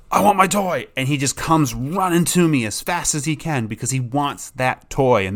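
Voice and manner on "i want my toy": higher, keen dog wanting its toy; lightly voiced eager dog. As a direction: chirp it like a dog begging.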